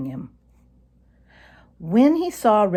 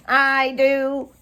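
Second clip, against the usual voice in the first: quick, high, cheeky answer; prompt jay reply; 8 semitones higher, 5 dB louder than usual. A narrator's reading. Sammy Jay answers Reddy at once, high and cheeky. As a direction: fire it straight back, pert and high. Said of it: "replied Sammy Jay promptly".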